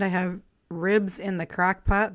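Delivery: usual speaking voice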